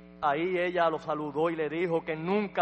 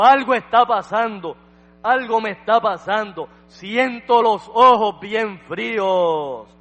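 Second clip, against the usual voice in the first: put-on loud, higher cry of a girl being healed; preacher voicing an amazed child, loud and raised; retold mounting wonder; 5 semitones higher, 9 dB louder than usual. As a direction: call out in rising astonishment. Retold girl feeling her healing begin.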